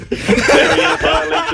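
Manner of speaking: Marion breaking into a high, breathless laugh; emotional outburst. Real, not acted. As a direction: let the name crack into a high laugh. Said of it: "I got a bit emotional during that".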